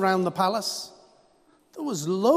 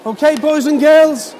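put-on high and loud, calling the kids; kindergarten teacher voice; 7 semitones higher, 9 dB louder than usual. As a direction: rouse the children to sing. Leading children before singing.

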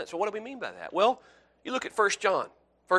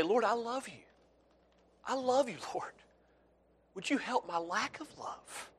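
soft, higher, intimate prayer voice; tender prayer; 5 semitones higher, 11 dB quieter than usual. Pretend. Believer quietly confessing love to the Lord, tender. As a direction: pray it softly like a child to a father.

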